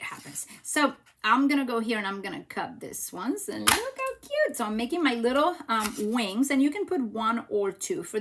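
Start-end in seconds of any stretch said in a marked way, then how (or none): 3.5-4.6 high-pitched delighted coo; squeal-like fondness at normal volume; cutesy gushing. Real, not acted. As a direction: coo over something adorable in a much higher voice. Gushing over the cute cut-out.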